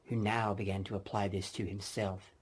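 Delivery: plain reading voice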